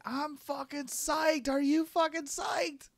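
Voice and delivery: very high, loud, slow hyped yell; hyped shout. Acted. Prince hyping Raz before the race, very high hyped yell. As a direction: pump him up like a wild fan.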